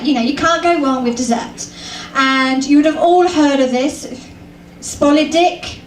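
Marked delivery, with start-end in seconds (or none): none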